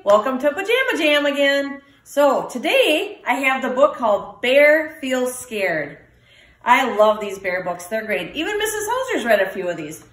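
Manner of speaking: ordinary delivery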